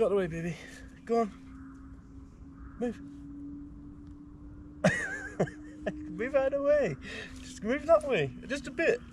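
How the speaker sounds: very high, sing-song pet talk. Real, not acted. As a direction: coax the dog in a squeaky voice. High voice coaxing the dog.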